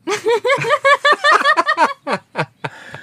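bursting into loud high laughter. Real, not acted. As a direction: cracks up laughing at the question. Host laughs at own question.

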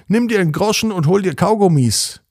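a lightly voiced grumpy neighbour; neighbour's call. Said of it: "Neulich hat er gesagt".

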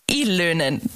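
Ylönen said the German way, ü and ö rounded, low fall; German pronunciation. Shown as a demonstration. Host showing how Germans say the surname. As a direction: give Ylönen a German reading. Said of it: "if you wanna hear it in German".

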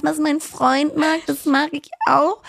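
mocking a fan girlfriend. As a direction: mimic her copying the boyfriend. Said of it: "die dann immer sagen so".

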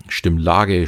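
normal talking voice

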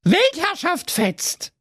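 very high, slow cheer; cheering goats. Acted. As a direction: shout it in triumph.

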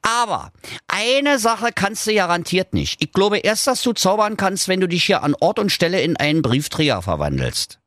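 high, mocking little dog, goading the wizard; cheeky dog voice. Dog Doktor Schulz goads the evil wizard, high and cocky.

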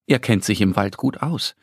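ordinary delivery

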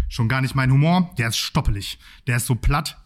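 usual speaking voice

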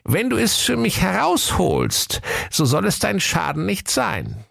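put-on very high old witch voice, coaxing; wheedling witch. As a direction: lure him with promises. Witch asks favour.

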